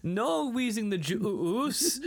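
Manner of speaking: high, slow, stretched juice; Eric Avari impression. Impersonating the shopkeeper to a friend, stretched juice. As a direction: drag out juice in his accent. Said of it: "Eric Avari".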